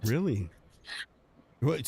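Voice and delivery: normal talking voice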